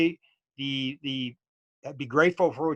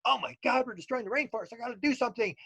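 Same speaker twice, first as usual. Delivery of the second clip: high-pitched alarmed outburst, getting softer; imitating a man freaking out, pitched up; 9 semitones higher, 4 dB quieter than usual. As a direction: panic at the realization. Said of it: "he's all freaking out".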